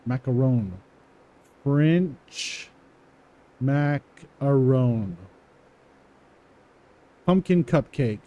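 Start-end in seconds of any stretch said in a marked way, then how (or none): 0.1-5.2 slow dictation. Shown as a demonstration. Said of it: "typing slowly after reading it".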